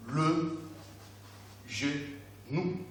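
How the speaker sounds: soft, slowly separated syllables. Shown as a demonstration. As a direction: model "le genou" in separate beats: le, ge, nou. Teacher models word by syllables.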